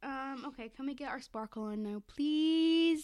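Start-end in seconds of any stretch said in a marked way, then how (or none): 2.1-3.0 a higher, louder whiny please; pleading. Real, not acted. Begging her friend to start the song. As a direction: beg for it playfully.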